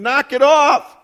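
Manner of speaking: loud, high-pitched yell of annoyance; exasperated shout. Acted. Jesus waking up to scold the storm, exasperated shout. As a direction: yell it like someone rudely woken.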